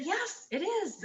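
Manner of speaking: put-on high, slow English-accented voice; [name]'s English accent. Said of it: "in his English accent".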